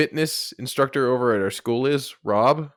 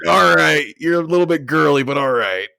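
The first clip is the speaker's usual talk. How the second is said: teasing coach; 2 semitones higher, 5 dB louder than usual. Retold or imitated. Coach mocking narrator as girly.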